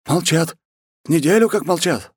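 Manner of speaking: high-pitched nervous underling; frightened report. Acted. New war chief answers the khan, high frightened voice. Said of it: "сказал испуганный новый исполняющий обязанности".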